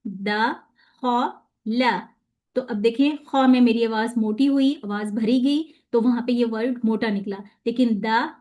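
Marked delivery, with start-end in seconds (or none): 0.1-2.1 द, ख, ला said apart, the ख heavy; syllable split. Shown as a demonstration. Teacher breaking a word into syllables for students. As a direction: split दखला as द, ख, ला. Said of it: "syllable अलग कीजिए और उनको पढ़िए".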